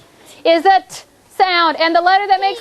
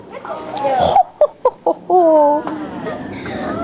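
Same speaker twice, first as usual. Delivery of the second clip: drawn-out, playful gloating laugh; teasing mock laugh; 2 semitones lower than usual. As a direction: laugh teasingly at their early answer. Gloating as pupils jump the gun.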